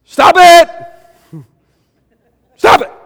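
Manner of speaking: sudden high yelled stop it; shouted stop it. Retold or imitated. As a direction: blurt it out like a startled pastor. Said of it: "And then finally he goes".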